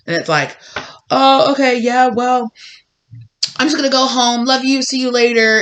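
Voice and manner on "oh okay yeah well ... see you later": higher and louder, forced brightness; fake cheerful. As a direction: cover your panic with chirpy politeness.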